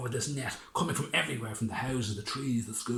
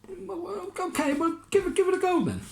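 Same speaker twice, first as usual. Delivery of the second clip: very high squeaky reluctant mayor; 6 dB louder than usual. Acted. Mayor reluctantly agreeing to the net plan in a squeak. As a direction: give in with a squeak.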